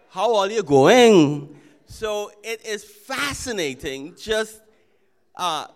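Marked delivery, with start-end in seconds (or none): 0.1-1.6 slow and loud with the Trini sing-song rise and fall; Trinidadian intonation. Shown as a demonstration. Pastor stressing the Trinidadian intonation for the congregation, slow loud Trini lilt. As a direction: lean hard into the Trini melody. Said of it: "there's intonations in it".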